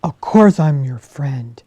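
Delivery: loud, gruff big-bear voice; a big bear sending the fox away, loud and grumpy. Acted. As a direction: shoo her off like a cross old bear. Bear voice in story.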